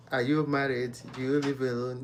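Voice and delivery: usual speaking voice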